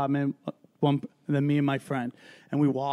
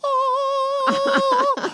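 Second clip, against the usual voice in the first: high squeaky oh of disbelief; a startled little gasp of a word; stunned. Retold or imitated. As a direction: caught off guard. Stunned at Rodman crying.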